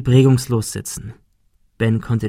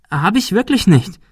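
high-pitched anxious protest; pleading denial; 9 semitones higher, 3 dB louder than usual. A narrator's reading. Girl insists on her innocence to the bully, pleading.

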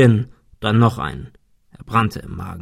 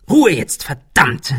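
higher, louder sharp snap; snapping; 4 semitones higher, 4 dB louder, slower than usual. Acted. Ben silencing panicking Arthur, snapping angrily. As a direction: cut him off with a hiss. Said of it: "fauchte Ben".

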